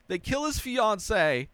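normal talking voice

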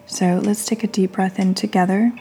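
guided meditation. Real, not acted. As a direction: lead them gently through each breath, leaving space between phrases.

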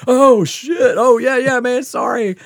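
high, loud, hurried backpedaling; acted-out alarm, loud and high; panicked retold apology. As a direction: blurt an apology as if caught at gunpoint. Acting out apology at gunpoint.

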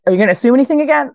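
high and very fast pointed question; retold scolding. Boss quizzing the employee after the sign mistake, fast and pointed. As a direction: rattle off the rebuke.

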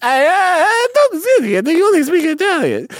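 over-the-top squeal of mock Italian; Italian accent. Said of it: "the Italian accent is really".

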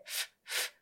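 ふ, ふ as soft breath through teeth on lip; voiceless F. Shown as a demonstration. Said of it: "下唇に上の歯を乗っけて".

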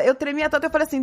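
ordinary talk

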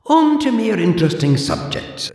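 put-on high, prim old librarian voice; haughty zombie librarian, clipped and proper; librarian Deirdre. Deirdre lectures the borrower in a high prim voice.

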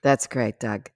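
low, underplayed brush-off; dismissive play line. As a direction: answer a big story with minimal interest. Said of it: "all she says is".

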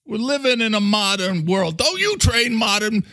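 high, badgering New York character; brash New Yorker demanding an answer; Mister Coffee. New Yorker grilling International about his work, high and pushy.

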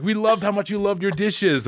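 high-pitched mock German accent. German couple telling a fellow diner they watched him, imitated German accent. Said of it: "Do your German accent".

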